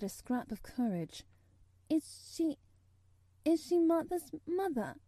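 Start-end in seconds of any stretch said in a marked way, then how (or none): 1.9-5.0 put-on high, hesitant child's stammer. A narrator's reading. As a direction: stammer it nervously, breaking after the first words. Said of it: "she stammered".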